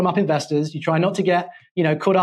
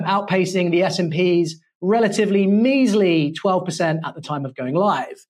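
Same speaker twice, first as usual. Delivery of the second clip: ordinary talk, nothing marked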